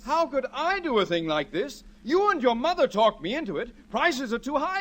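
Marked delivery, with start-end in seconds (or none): 0.0-1.8 higher, stressing I, rising; husband echoing daughter's reproach, offended; indignant echo. Acted. Husband echoes daughter's accusation indignantly. 3.9-4.8 higher, mocking their words; husband parroting family's complaint, higher; mimicking family. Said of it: "you said".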